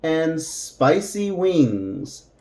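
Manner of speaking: slow, careful model phrase; slow demo. Shown as a demonstration. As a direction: say it slowly for learners. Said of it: "this is how it might sound".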